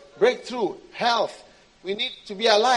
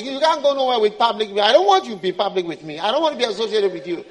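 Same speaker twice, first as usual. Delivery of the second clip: fast, louder brush-off; dismissive God; 7 dB louder, faster than usual. Acted. God refusing to be seen with the believer, dismissive. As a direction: wave them off, embarrassed by them.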